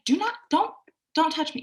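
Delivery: high, forceful do not touch me; firm no. Shown as a demonstration. Client telling the grabby man off, forceful and high. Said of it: "use your voice, and say".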